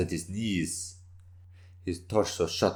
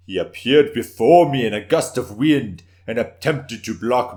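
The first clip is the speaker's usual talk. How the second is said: loud boasting tale; 7 dB louder than usual. Acted. Warrior boasting to the council.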